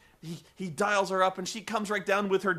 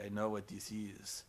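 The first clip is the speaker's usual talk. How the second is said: much lower and softer, grave storyteller voice; imitating the wise security guard from Devil, low and hushed; solemn wise guard; 8 semitones lower, 9 dB quieter than usual. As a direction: hush it down like an old legend. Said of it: "security guard who's like".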